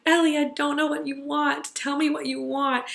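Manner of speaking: helpless pleading. Retold or imitated. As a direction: plead with a crying child, at a loss. Retold plea to crying child.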